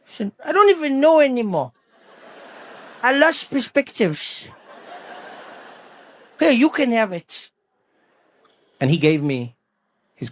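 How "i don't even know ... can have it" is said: mildly higher, despairing schoolboy voice; lightly voiced weary resignation; defeated schoolboy. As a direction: give up with a touch of mock tragedy. Acting the defeated friend giving up.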